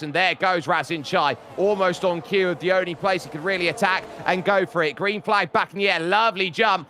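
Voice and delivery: rising, excited restart call; live race commentary. Real, not acted. Commentator calling the restart jump to viewers, excited play-by-play. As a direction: build in pitch as the green flag drops.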